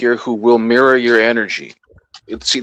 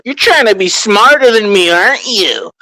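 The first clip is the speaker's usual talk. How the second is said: higher, louder mock accusation; dumb hostile human; 10 semitones higher, 5 dB louder than usual. Imagining a human shooting the alien, loud mock accusing voice. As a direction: play the dumbest human glaring at an alien, suspicious. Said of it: "the dumbest fucking human is gonna ... be like".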